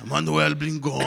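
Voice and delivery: loud, put-on voice announcing a name; a loud stranger giving his name; loud character voice. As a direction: boom out your name.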